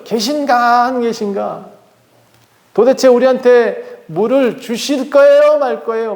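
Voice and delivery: high mock demand, the first words slow; demanding challenge. Israelites challenging God for water, high mock indignation. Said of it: "막 따지고".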